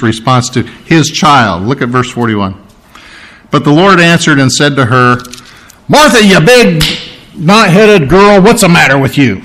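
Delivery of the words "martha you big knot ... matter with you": hammed-up telling-off; mock scolding. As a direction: scold her like a fed-up relative. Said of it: "Do you notice He didn't do that?".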